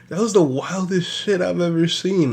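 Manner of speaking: a touch of laughter, high; amused disbelief. Real, not acted. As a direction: say it through leftover laughter.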